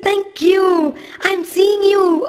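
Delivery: put-on very high joyful child's voice; delighted little boy. A narrator's reading. Little boy seeing Lord Shiva at last, delighted. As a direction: burst out with happy wonder. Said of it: "he was so delighted".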